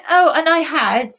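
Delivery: usual speaking voice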